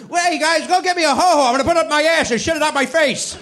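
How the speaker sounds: mock shock comedian. Imitating a shock comic.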